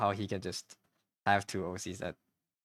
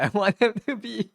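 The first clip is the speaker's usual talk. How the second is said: laughing; 12 semitones higher, 10 dB louder than usual. Real, not acted. Laughs at own lack of skill.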